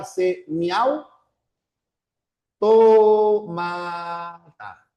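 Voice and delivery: "tomata" drawn out slowly, syllable by syllable; teacher dividing "semiautómata" into syllables. Shown as a demonstration. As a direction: sound out "C, miau, tomata" in separated chunks. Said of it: "en semiautómata tienes".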